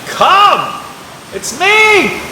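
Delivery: big, loud, drawn-out call; calling over the wind. Acted. Jesus calling to the boat through the storm, loud call. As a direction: project each word over wind and waves. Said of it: "over the sound of the blowing wind".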